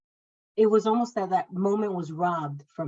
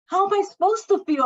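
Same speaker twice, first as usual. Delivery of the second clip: much higher, louder, incredulous cry; angry outburst; 14 semitones higher, 5 dB louder than usual. Retold or imitated. Mother answering the midwife's question, high incredulous outburst. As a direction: hurl the question back in disbelief.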